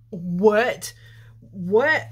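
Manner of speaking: loud, incredulous double what; stunned what. Retold or imitated. Retold shock at being recognised.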